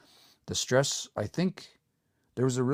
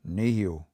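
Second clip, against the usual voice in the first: Nehio with the first syllable, ne, stressed; 3 semitones lower than usual. Shown as a demonstration. Teacher models Nehio for learners, stress on ne. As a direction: model Nehio, ne strong and the rest falling. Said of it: "say it exactly the way you hear it".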